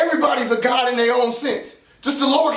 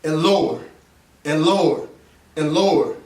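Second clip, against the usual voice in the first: a fading inner voice, sinking lower with pauses; getting lower; 8 semitones lower than usual. Shown as a demonstration. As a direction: drop the pitch low, step by step. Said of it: "the voice is gonna start getting lower".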